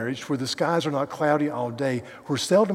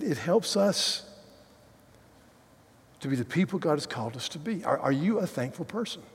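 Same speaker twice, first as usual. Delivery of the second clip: ordinary talk, nothing marked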